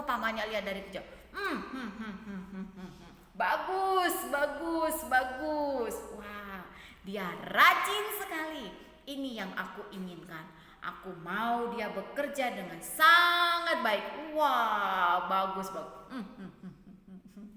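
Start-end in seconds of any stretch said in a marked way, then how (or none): 1.3-6.0 pleased uncle voice. Acted. Uncle Laban watches, pleased. 6.2-17.5 scheming uncle voice. Acted. Laban voiced, pleased and scheming.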